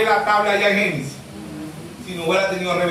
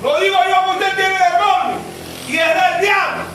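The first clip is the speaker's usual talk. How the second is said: accusing shout; 9 semitones higher than usual. Retold or imitated. Preacher mimicking church folk who shout he has a demon. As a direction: hurl the accusation at him. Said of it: "mira uno así".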